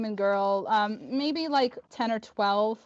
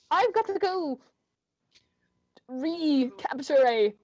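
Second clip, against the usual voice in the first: lightly voiced character, higher at first; Kriv; 4 semitones higher, slower than usual. Kriv excusing himself to the group, halting character voice. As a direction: start high, then slow down to a halt. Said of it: "Kriv kinda looks at you all".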